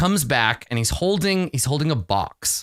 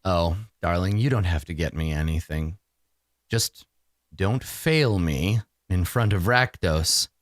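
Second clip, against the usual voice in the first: lower, measured villainess purr; menacing mistress; 5 semitones lower than usual. Acted. Mistress warning her minion not to fail, low and deliberate. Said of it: "and she says".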